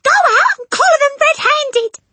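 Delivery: very high, loud, shrieky order; shrill at him to act; urgent panicked hiss. A narrator's reading. Mother orders husband after burglars, shrill and frantic. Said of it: "hissed the mother".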